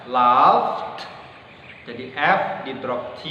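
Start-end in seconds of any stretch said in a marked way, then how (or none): 0.1-1.0 British accent "laughed" with a long a and f. Shown as a demonstration. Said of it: "Kalau kalian pakai British accent". ("laughed" dengan aksen British)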